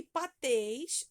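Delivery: patês, slow, with a loud final tês; stress on last syllable. Shown as a demonstration. Teacher helping pupils find the strong syllable, patês stressed on tês. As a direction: stretch and punch the tês in patês. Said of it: "você vai sublinhar também o teis".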